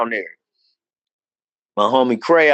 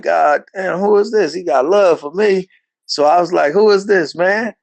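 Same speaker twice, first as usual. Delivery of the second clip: puzzled; 6 semitones higher than usual. Retold or imitated. Puzzled by crying caller.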